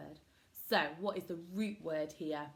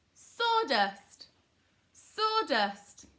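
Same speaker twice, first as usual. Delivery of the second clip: loud, high call-out falling at the end; modelled word; 8 semitones higher, 8 dB louder, much slower than usual. Shown as a demonstration. Teacher blending syllables for pupils, loud high call. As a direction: sing the word out high for a class to echo.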